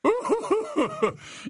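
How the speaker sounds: high-pitched professor's chuckle; chortle. Acted. Slughorn amused at his own remark, chortling. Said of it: "Il pouffa à nouveau".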